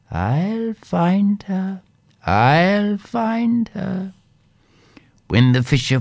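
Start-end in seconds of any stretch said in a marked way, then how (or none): 0.1-1.8 slow and rising, drawn out; slow drawn-out promise. A narrator's reading. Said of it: "she said". 2.3-4.1 slow and high, firmer; slow insistent repeat. A narrator's reading. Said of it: "she said".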